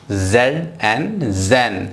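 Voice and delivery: "Z एन" drawn out, then "Zain" with a z sound; slow blending. Shown as a demonstration. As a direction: sound it out slowly with a buzzing z. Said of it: "जेन नहीं बोलना है". (धीरे "Z एन, ज़ैन" ज़ की आवाज़ के साथ)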